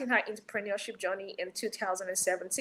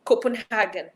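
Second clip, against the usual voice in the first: usual speaking voice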